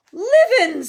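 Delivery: high, very loud exclamation; fake astonishment. A narrator's reading. Sir Patrick spotting live guests, mock astonished. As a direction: exclaim in hammed-up surprise.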